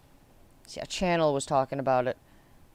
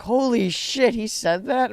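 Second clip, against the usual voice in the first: shocked disbelief; 8 semitones higher, 7 dB louder, slower than usual. Retold or imitated. Teachers shocked at racist remark.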